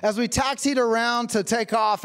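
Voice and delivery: ordinary talk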